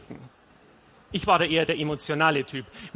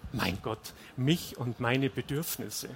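low, soft, slow muse; quiet self-mockery; 3 semitones lower, 5 dB quieter, slower than usual. A narrator's reading. Husband alone on balcony hears himself, quiet self-mockery. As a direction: mutter it to yourself, embarrassed. Narrator scorns his own complaint.

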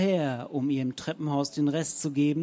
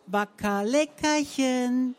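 much higher, slow sing-song coax; Zenker voice; 8 semitones higher, slower than usual. A narrator's reading. Romanow coaxes the poodle in Frau Zenker's voice, slow. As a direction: coax the dog as its owner. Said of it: "tönte die Zenkerstimme".

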